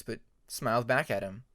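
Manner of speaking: ordinary delivery, nothing marked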